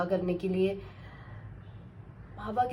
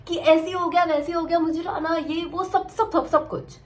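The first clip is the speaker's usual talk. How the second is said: high, loud imitation of an over-excited friend; excited chatter; 8 semitones higher, 6 dB louder than usual. Friend bursting to tell the whole village, excited. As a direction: rattle it off like a thrilled friend making a fuss. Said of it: "excite हो जाती है".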